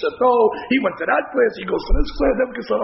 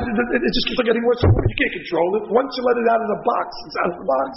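ordinary talk, nothing marked

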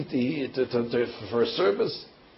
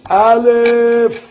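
top-of-the-lungs cry, high and loud; shouted at full voice; 8 semitones higher, 8 dB louder than usual. A narrator's reading. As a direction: call the letter out with all your breath. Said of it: "on top of his lungs says".

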